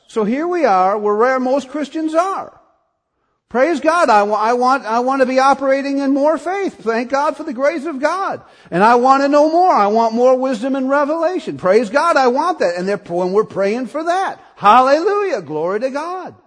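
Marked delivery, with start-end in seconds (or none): 3.5-12.8 raised, louder voice building with desire; rising fervent sermon cry; fervent preaching. Acted. Preaching at full force. 14.6-16.3 high, loud praise shout; full-voiced hallelujah; fervent hallelujah. Real, not acted. Preacher's exclamation.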